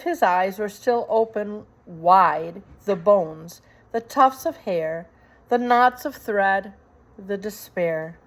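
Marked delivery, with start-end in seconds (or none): none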